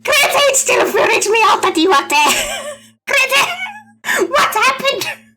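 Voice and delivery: high, loud squeal breaking into laughter; real helpless laughter through the words; laughing outburst. Real, not acted. Streamer freaked out by Grete being there, laughing outburst.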